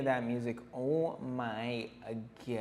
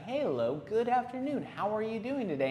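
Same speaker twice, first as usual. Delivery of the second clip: chirpy greeting; 7 semitones higher than usual. Retold or imitated. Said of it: "you're like".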